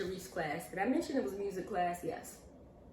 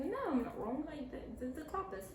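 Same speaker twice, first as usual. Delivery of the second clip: high, halting put-on British accent; 6 semitones higher, 3 dB quieter than usual. Student defending an answer to the class, imitated British accent. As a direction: insist in a mock British accent. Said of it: "Why are you talking like that?".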